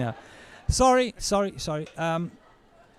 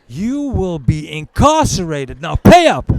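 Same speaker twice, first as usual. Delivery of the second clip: threatening demand; 10 dB louder than usual. Acted. Bailiff demanding payment from the narrator, rising to a shout. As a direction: lay down the law then explode.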